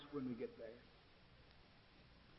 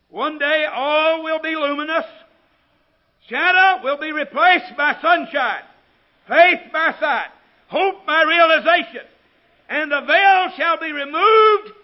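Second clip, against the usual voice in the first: high, shouted preacher's proclamation; ringing phrases with pauses between; full-force preaching; 12 semitones higher, 17 dB louder, slower than usual. Real, not acted. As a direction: hammer out each promise from the pulpit, loud and high.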